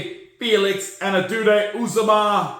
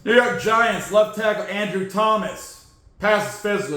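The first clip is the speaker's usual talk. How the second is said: usual speaking voice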